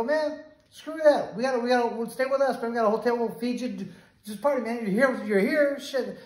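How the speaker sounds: high, lively retold urging; eager invitation. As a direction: talk a buddy into staying. Acted insistent invitation to stay.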